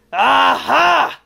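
very loud gloating aha; villain's cry. Acted. Villain catching the monster, crying out. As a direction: burst out like a horrible villain. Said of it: "cried the horrible Heap".

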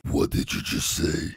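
breathy, slow menacing rasp; parrot voice. Acted. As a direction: threaten in a raspy bird voice. Parrot voice threatens the player.

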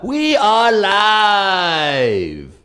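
high, very drawn-out call, fading; stretched show open. Shown as a demonstration. Host testing openers, long drawn-out announcer call. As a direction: hold the last word long like a ring announcer. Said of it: "Anyone like any one of those?".

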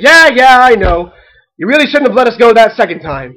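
loud, impatient backtalk; dismissive kid. A narrator's reading. Duckling cutting off mother's scolding, dismissive. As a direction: brush Mom off before she can speak. Duck cuts mother off defensively.

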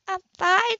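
too quick, too bright denial; flustered Grace. A narrator's reading. Grace held by Ethan, high and flustered.

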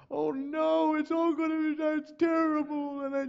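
high, loud mock panic; hammed-up alarm at disaster; panicked wail. Pastor mocking his own panic over church trouble, high wail. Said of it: "it's like".

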